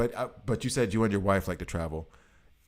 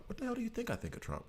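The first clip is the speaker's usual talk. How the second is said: exasperated retort; 11 dB quieter, much faster than usual. Retold or imitated. Exasperated answer to nosy question.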